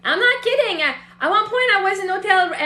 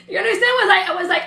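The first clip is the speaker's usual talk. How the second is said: ordinary delivery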